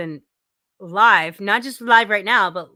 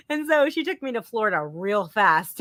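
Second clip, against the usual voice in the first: ordinary talk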